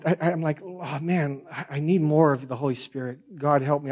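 ordinary talk, nothing marked

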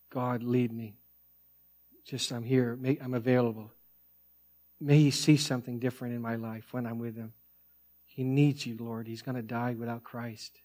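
soft, low prayer with long pauses; quiet prayer. Acted. Preacher praying to God for an elderly relative, hushed prayer.

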